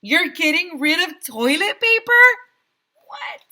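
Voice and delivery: high, loud disbelieving cry; shocked shout. A narrator's reading. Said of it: "shouted Alexia".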